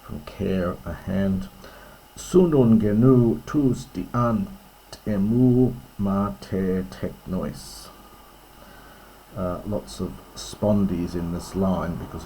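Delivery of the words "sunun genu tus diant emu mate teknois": slow and weighty, leaning on the long syllables of "Sunun genu tus diant"; ponderous, emphatic. Shown as a demonstration. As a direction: give every heavy syllable of "emu mate teknois" its full weight. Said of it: "it's quite a ponderous, emphatic line".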